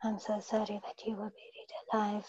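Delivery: soft whispered repeat. Real, not acted. Client repeating the healer's words in a whisper.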